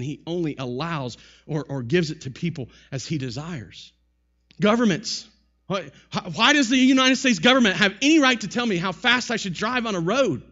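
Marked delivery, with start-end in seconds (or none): 4.6-10.4 higher, louder protest at the rules; mock-indignant challenge, pitched up; indignant citizen. Citizen objecting to government speed limits, indignant.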